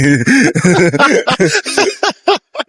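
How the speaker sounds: laughing at telling mom she looks bad; big laugh. Real, not acted. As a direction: crack up at your own story. Laughs after blunt quote.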